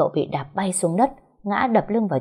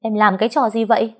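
higher, louder scolding; angry shout; 5 semitones higher, 4 dB louder than usual. A narrator's reading. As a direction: bark at her in shock. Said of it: "Cậu Long quát lớn". (quát lớn giận dữ)

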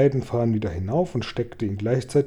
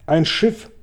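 a sudden cry, pitch jumping up; sudden shout; 5 semitones higher, 4 dB louder than usual. A narrator's reading. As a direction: call out the sighting in surprise. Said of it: "schrie James plötzlich".

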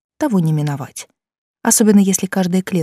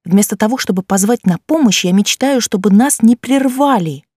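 ordinary delivery, nothing marked

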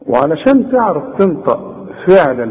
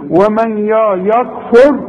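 ordinary delivery

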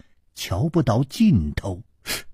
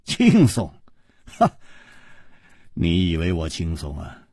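wry retort with a short scoff; bitter laugh; 8 semitones lower than usual. Acted. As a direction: echo it in disbelief, then sink low. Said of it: "楚留香苦笑着说".

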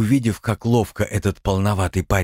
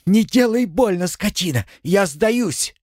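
high tearful cry through pain; angry crying; 12 semitones higher than usual. A narrator's reading. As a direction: scream it through tears. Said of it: "сквозь слёзы прокричала".

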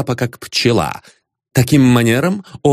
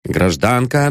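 ordinary delivery, nothing marked

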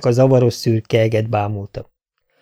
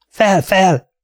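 higher, raised-voice order; urgent command; 8 semitones higher, 3 dB louder than usual. A narrator's reading. Dunk urges the fallen prince to rise, commanding. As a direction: call it out like ordering a horse up. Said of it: "mondta, miként mennydörgésnek is a viadal során".

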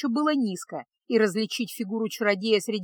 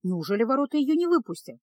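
ordinary delivery, nothing marked

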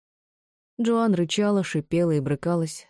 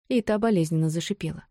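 ordinary delivery, nothing marked

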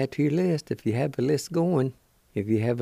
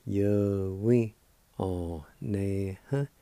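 slow syllables; much slower than usual. Shown as a demonstration. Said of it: "And together".

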